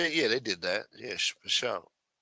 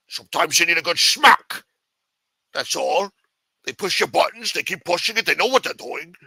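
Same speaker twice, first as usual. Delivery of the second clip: higher, louder mock Scottish Connery voice as Washington; Sean Connery accent; 5 semitones higher, 4 dB louder than usual. Washington advising on wives, Scottish Connery accent. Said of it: "If he didn't sound like Sean Connery".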